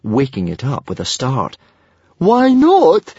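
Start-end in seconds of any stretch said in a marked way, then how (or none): 2.2-3.1 high, loud squeak of protest; squeaky, high-pitched outburst. A narrator's reading. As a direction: squeak it out in alarm. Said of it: "squeaked Fishlegs".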